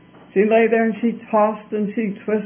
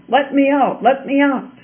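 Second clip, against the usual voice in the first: lightly dramatized high plea; crying out; 5 semitones higher than usual. A narrator's reading. Trapped self begging the princess for release, mild high cry. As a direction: plead higher, as a trapped inner voice. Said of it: "cried the voice again".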